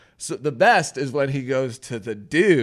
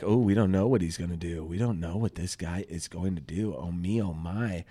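feigned wondering in a low, softer voice; mock suspense; 7 semitones lower, 6 dB quieter than usual. Recapper mocking the show's fake suspense, low mock wondering. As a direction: play at not knowing, low and trailing quieter. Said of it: "trying to make it seem like".

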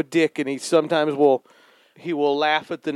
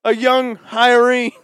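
dramatic, louder and higher; passionate; 4 semitones higher, 4 dB louder than usual. Acted. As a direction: tell it like a grand exposé. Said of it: "more and more passionate".

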